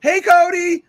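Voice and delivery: high, loud cheery neighbour greeting; imitating [name]. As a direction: call out a bright friendly hello. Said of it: "he knew Cody's name".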